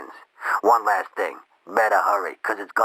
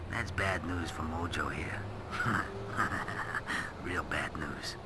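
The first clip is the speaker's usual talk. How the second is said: much softer, menacing whispered taunt; hushed threat; 11 dB quieter than usual. Acted.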